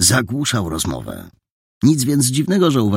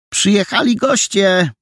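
higher call announcing guests into the house; 13 semitones higher than usual. Acted. Housekeeper calls into the room, higher, announcing guests. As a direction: call back into the room that guests are here. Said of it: "zawołała w głąb pokoju".

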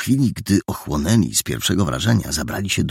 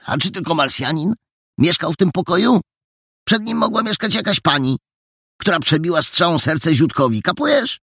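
teasing explanation; 12 semitones higher than usual. Acted.

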